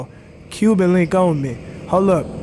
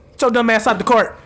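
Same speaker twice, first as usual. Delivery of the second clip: usual speaking voice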